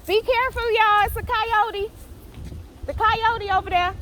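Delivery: high, loud warning call; urgent warning. Real, not acted. Vlogger warning her viewers the coyote is right there, high and loud.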